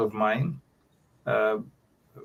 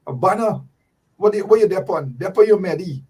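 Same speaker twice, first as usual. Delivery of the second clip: Guyanese Creolese; 7 semitones higher, 4 dB louder than usual. Retold or imitated. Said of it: "you got to speak proper".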